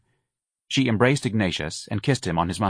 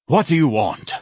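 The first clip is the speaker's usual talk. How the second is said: breathless; 6 semitones higher, 4 dB louder than usual. A narrator's reading. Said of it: "a breathless voice asked".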